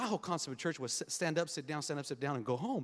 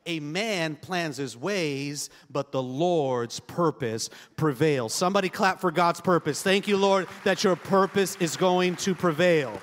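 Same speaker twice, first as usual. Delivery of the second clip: loud, slow, drawn-out preaching; full-force preaching; 9 dB louder, slower than usual. Acted. Pastor to congregation, loud slow preaching. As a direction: proclaim it to the whole church, slowly.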